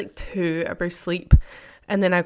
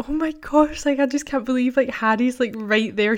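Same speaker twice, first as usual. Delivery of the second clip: excited gush; 5 semitones higher than usual. Retold or imitated. Girl amazed at the concert.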